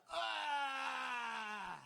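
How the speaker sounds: long, high, loud dying wail; mock death scream. Comic voicing the spider as it dies, mock death scream. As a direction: scream out a drawn-out last cry. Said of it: "I heard him go".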